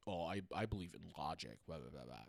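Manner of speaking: mock the logic people, dropping away to nothing; mocking skeptic. Mocking people who don't believe in ghosts, in a low, dismissive voice. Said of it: "I hate the people who are like".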